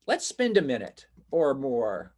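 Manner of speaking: normal talking voice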